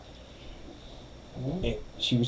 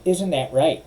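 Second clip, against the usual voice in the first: higher, sharp and accusing; acrimonious tone; 6 semitones higher than usual. Shown as a demonstration. Imitating the lawyer at the witness, acrimonious. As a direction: needle the witness with it. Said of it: "she's got that acrimonious tone".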